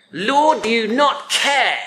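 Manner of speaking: mild angry reproach. Acted. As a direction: challenge him with a touch of anger. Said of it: "angry rant".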